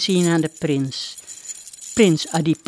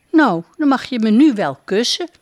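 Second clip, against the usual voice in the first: laughing; 5 semitones higher, 5 dB louder than usual. A narrator's reading. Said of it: "lacht Devi Melati".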